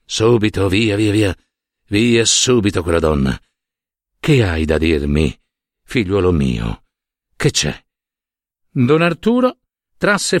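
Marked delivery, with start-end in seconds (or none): 0.0-7.8 low, measured priestly speech; monsignor's voice. Acted. Monsignor receives his visitor, low priestly voice. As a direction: lower your pitch, a churchman receiving a caller.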